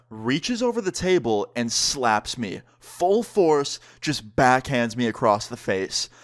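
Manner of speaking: usual speaking voice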